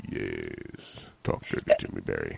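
a deep, hoarse, sultry croak; sick voice. Pretend. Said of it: "power of my, uh, sick voice".

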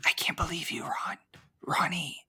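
whispered mock quarrel; an angry fight, but whispered like ASMR. Mocking ASMR drag queen fight.

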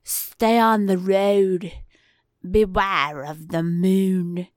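slow, drawn-out mock English warning; a bad English villager's ominous caution; tipsy, slow English accent attempt; British accent attempt. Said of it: "Did I sound English?".

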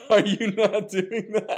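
high, laughing incredulous question. Real, not acted. Guest teasing host about Rogaine gloves, laughing.

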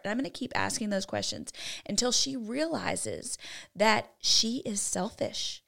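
normal talking voice

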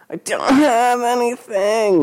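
very high, strained, drawn-out plea; choked out. A narrator's reading. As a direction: choke it out in desperate fear. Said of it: "he choked out".

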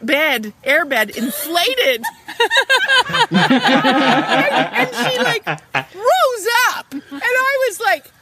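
high, laughing, breaking up; laughing in shock. Real, not acted. Bed suddenly inflating under mom.

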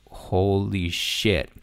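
slow, drawn-out awe; retold amazement. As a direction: stretch out each word as a recalled reaction. Retells reaction to doctor's email.

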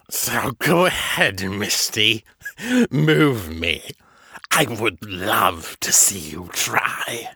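slow, sneering dare; taunting villain voice. Acted. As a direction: goad her like a cocky monster. Villain daring Misty.

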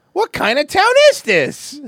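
high, loud disbelief; can't believe what he just heard; incredulous. Real, not acted. Incredulous joke at news clip.

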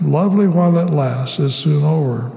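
ordinary talk

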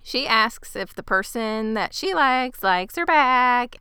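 normal talking voice

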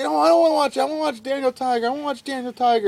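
high-pitched imitation of a protesting child; whining little boy. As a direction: mimic a child's high whine. Young son protesting, quoted.

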